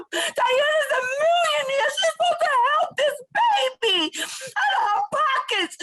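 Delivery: real tearful shouting at a betrayal; crying outcry. Real, not acted. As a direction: sob it out in raw anger. Tearful outcry against Diane.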